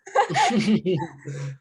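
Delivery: laughing. Real, not acted. Laughs at "I don't" joke.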